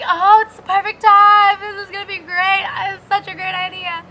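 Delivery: mock how sold you were on the idea; tipsy enthusiasm. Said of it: "belligerently, you know, walking around".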